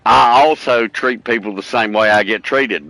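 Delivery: ordinary talk, nothing marked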